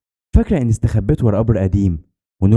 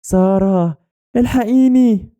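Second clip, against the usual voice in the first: very high, drawn-out cry for help; crying plea; 12 semitones higher, much slower than usual. A narrator's reading. Trapped friend begs Sara from behind the door, crying plea. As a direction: wail pleadingly like a trapped child. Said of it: "صوت نور بتعيط".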